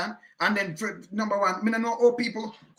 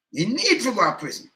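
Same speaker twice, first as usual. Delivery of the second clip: louder, higher, need hammered; angry; 6 semitones higher, 5 dB louder than usual. Real, not acted. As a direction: demand it with rising anger.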